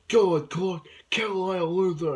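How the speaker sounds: loud, high urging to kill; mock villain's shout. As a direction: call it out like a villain egging someone on. Voicing villain urging Clark to kill.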